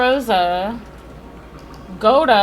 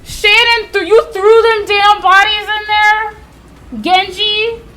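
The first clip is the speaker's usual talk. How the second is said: loud, high-pitched indignation; real indignant yelling, pitched up; outraged outburst; 8 semitones higher, 4 dB louder than usual. Real, not acted. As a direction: call out the culprits in disbelief. Outraged reaction to servants in game.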